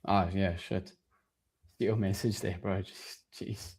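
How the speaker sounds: ordinary talk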